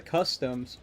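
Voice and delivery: ordinary talk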